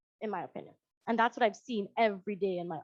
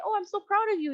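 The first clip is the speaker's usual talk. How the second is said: praising parent; 9 semitones higher, faster than usual. Retold or imitated.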